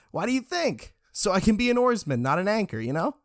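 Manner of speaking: high-pitched, indignant comeback; scoffing retort. A narrator's reading. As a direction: answer as if it's obvious, pitch lifted. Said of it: "Richard scoffed, answered".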